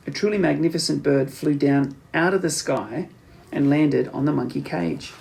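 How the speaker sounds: ordinary delivery, nothing marked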